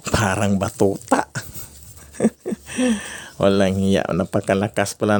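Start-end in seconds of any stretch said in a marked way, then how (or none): 2.2-3.2 a sudden high-pitched laugh. Real, not acted. Vlogger laughing at a box of fireworks, high laugh. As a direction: burst out laughing high at the size.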